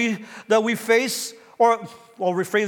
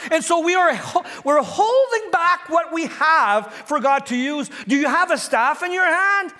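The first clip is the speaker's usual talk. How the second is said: rising, higher and urgent preaching; preaching at full force; 5 semitones higher than usual. Acted. Preacher urging the congregation to give it to God, fervent. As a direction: drive it home to the congregation.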